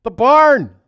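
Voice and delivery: high, loud yell; man yelling to birds in a storm; shouted call. Acted. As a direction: call out to the birds across the barn. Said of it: "and he goes".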